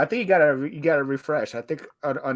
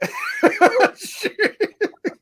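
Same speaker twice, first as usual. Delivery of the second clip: shrill laugh at a picture sent in chat; high laugh. Real, not acted.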